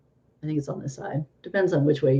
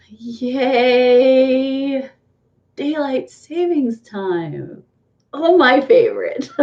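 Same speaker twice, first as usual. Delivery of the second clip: higher-pitched, drawn-out mock delight; sarcastic cheer; 6 semitones higher, much slower than usual. Vlogger mocking the clock change to viewers, sarcastic cheer. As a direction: stretch it out with fake enthusiasm.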